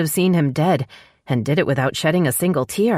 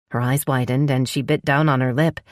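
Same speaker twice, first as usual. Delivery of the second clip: ordinary delivery, nothing marked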